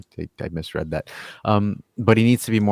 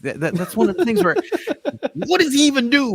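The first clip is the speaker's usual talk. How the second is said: high, loud, incredulous pitch with a laugh; exasperated; 6 dB louder than usual. Real, not acted. Host rants about Chiesa switching wings, incredulous and exasperated. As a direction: disbelief cracking into a laugh.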